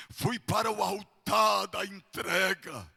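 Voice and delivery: mounting revival-preacher fervour; rising preaching. Real, not acted. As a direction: swell from firm testimony into a loud cry. Preaching rising to full force.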